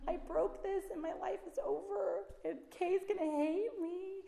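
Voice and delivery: put-on high, wailing little-girl voice; mock tearful child, pitched high; imitating sobbing daughter. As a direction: play the child who thinks her life is over. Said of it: "she's sobbing".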